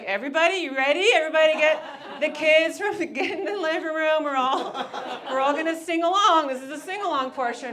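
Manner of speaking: rally the stadium to sing along; hyped concert call. Retold or imitated. Rapper hyping a stadium crowd, excited loud call. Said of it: "but really he was like".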